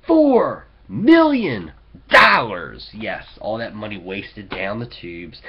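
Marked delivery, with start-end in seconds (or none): 0.1-2.9 loud, slow, wailing outrage; outraged. Real, not acted. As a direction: cry out the sum in disbelief. Outrage at wasted money.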